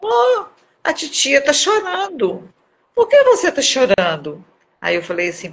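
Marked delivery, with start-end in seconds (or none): 0.0-4.4 put-on high, slow child voice, curious; little girl asking the crying auntie, high and slow; little girl's voice. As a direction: imitate a four-year-old asking sweetly. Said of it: "uma menininha viu eu chorando".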